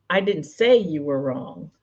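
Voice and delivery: slow, with SAY punched; emphasised word. Shown as a demonstration. Coach showing how emphasis changes a sentence, stressed "say". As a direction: put the weight on "say". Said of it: "my emphasis on those words".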